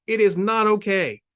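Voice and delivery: raging. A narrator's reading. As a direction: shake with rage at him. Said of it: "now vibrating with rage".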